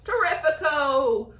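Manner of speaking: loud gleeful cry; excited cry. Acted. Aunt crying out over the peach, gleeful. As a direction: cry it out with glee. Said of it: "Aunt Sponge cried out".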